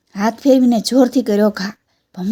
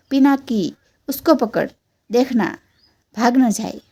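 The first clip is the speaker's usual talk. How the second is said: usual speaking voice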